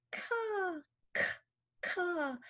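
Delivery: soft, high-pitched crow caw; bird cawing. Shown as a demonstration. Therapist likening K to a bird, high soft caws. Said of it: "like a bird going".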